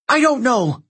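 high-pitched yell. A narrator's reading. Annabeth answers Percy amid the fighting, yelling. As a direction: yell it back over the din. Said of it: "she yelled".

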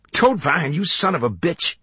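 high-pitched outraged curse; indignant high cry up the stairs; angry cursing. A narrator's reading. Clerk curses attacker on stairs.

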